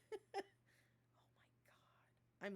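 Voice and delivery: breathless whispered nervous laugh. Real, not acted. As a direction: giggle it out under your breath. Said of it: "I'm laughing nervously".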